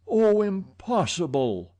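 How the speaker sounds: high, slow anguished moan. A narrator's reading. Raoul moaning to the commissary in disbelief. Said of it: "moaned Raoul".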